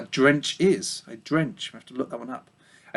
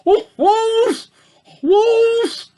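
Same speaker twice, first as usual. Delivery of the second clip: put-on very high, loud grumbling old sheep cry; hoarse complaining old ewe; 6 dB louder, much slower than usual. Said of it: "a hoarse, complaining voice".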